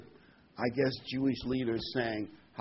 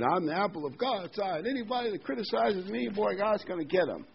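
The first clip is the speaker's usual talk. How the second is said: a hint of a boastful TV preacher, pitched higher; mocking TV preacher; 7 semitones higher than usual. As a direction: lightly mimic the con man's bragging. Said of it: "he was on Television for Religion".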